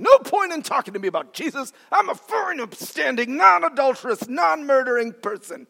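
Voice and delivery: high-pitched self-righteous imitation; certain, closed-off woman. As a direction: insist with mock indignation, pitched high. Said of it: "She was certain, clamshell certain".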